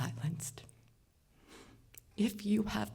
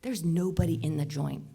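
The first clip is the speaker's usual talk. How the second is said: usual speaking voice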